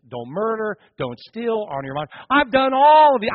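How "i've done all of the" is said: high, loud protest of innocence; indignant boast. Retold or imitated. Rich young ruler insists to Jesus, loud and high. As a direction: bark out how blameless he has been.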